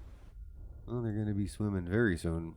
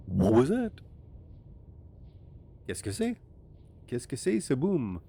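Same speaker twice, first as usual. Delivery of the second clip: put-on French accent, higher, startled; 5 semitones higher than usual. Sierra one wondering about the boom, mock French accent. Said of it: "I did it with a French accent".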